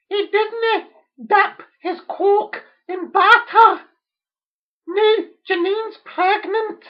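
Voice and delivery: very high, loud, slow Scots outcry; overdone Scottish kitchen-sink drama; mock Scottish wail. Mother cries to father in mock Scottish. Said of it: "like one of Ken Loach's Scottish movies".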